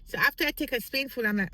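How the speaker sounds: ordinary talk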